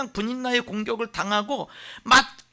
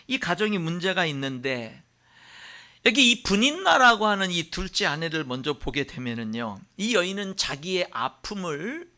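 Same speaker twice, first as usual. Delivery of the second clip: ordinary talk, nothing marked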